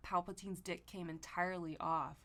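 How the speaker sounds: normal talking voice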